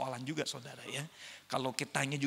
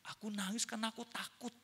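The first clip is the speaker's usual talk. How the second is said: put-on higher, softer little-boy voice; scared child; 7 semitones higher, 6 dB quieter than usual. Son confessing to his mother, timid child. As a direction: lightly voice a small boy confessing. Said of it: "Anaknya ngomong gini".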